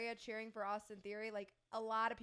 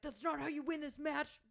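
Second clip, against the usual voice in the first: retold yelling; 4 semitones higher than usual. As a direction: imitate a scolding tag partner. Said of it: "starts yelling at him".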